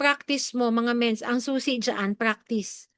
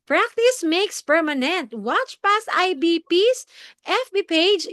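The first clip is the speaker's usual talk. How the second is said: high, slow, hammed-up presenter voice; overexcited; 8 semitones higher, 3 dB louder, slower than usual. Shown as a demonstration. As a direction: overact it with big pitch swings. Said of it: "hindi naman pwedeng all excited ka".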